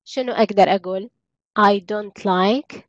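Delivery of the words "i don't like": drawn-out teacher modelling; slow classroom example; very slow model sentence. Shown as a demonstration. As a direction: stretch each word for learners. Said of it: "teacher models English sentence".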